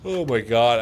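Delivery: yawning through the words. Real, not acted. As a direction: say it mid-yawn. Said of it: "I'm sorry that I'm yawning".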